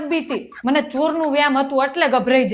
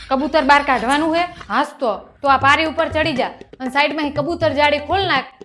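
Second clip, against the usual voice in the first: usual speaking voice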